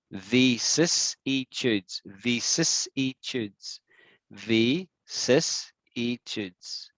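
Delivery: vicissitudes drawn out syllable by syllable, very slowly. Shown as a demonstration. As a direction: drag out every sound of vicissitudes. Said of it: "now I'm gonna say it really slow".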